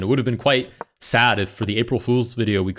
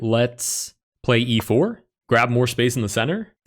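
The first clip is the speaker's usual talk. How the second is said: "four" and "center" lifted at the end like a question; question intonation. Real, not acted. Chess player unsure of the move, questioning tone. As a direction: say the move as if asking, rising on "center". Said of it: "I say that like it's a question".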